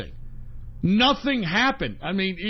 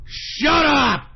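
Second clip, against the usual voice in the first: angry yell; 5 semitones higher, 4 dB louder than usual. Real, not acted. Yelling at Musk fans.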